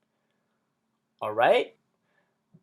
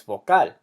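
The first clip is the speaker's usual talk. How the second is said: "vocal" corrected to vo-CAL; "vocal" with a stressed final syllable; stress on last syllable; 4 semitones lower, 4 dB louder than usual. Shown as a demonstration. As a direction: land firmly on the -cal of "vocal". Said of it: "emphasize the last syllable".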